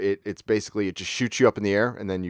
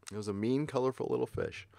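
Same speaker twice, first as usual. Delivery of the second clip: quiet aside; 3 semitones higher, 10 dB quieter than usual. Real, not acted. Quieter repeat of same phrase.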